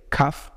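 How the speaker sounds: "cuff" with the final E left silent; cafe without accent. Shown as a demonstration. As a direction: say "cuff", one syllable, no final vowel. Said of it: "if I have C A F E".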